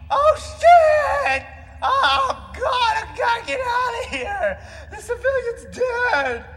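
high, loud panicked crying; panicked wailing. Acted. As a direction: wail in mock panic. Acted panic after civilian dies.

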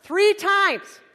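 loud, high punched phrases; emphatic exasperation. Real, not acted. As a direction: hammer each phrase out loudly. Emphatic climax of friend's retest story.

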